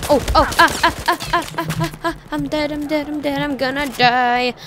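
high, frantic cries of dying; panicking gamer. Real, not acted. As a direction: yelp in panic as you die. Gets killed in game.

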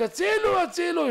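very high-pitched cry for help. Acted. Dreamer choking himself again, crying out for help. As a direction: call out desperately, voice pitched right up. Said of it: "והוא צועק".

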